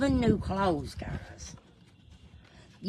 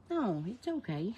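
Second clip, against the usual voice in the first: ordinary delivery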